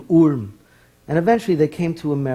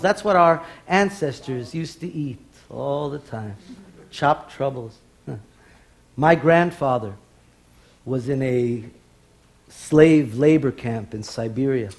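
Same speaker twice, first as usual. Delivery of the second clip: ordinary talk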